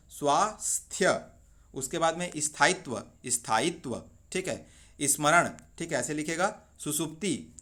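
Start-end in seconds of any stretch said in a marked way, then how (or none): 0.1-1.2 स्वास्थ्य said slowly, each cluster clear; slow careful pronunciation. Shown as a demonstration. Teacher to students, slowly modelling स्वास्थ्य. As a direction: stretch स्वास्थ्य sound by sound. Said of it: "दोनों सा छोटा है".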